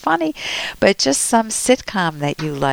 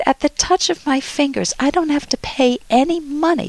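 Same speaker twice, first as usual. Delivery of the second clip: higher, imitated Vietnamese accent; 8 semitones higher than usual. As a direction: lightly mimic a Vietnamese nail tech's accent. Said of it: "he says this in a Vietnamese accent".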